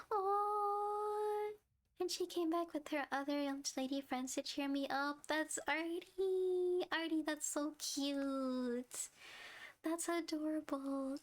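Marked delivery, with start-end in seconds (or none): 0.1-1.5 sharp high gasp, near tears; a real emotional gasp, much higher; touched gasp. Real, not acted. Moved by sweet story. 5.3-11.1 slow, much higher gushing over a sweet friend; a real touched gush, slow and high. Real, not acted.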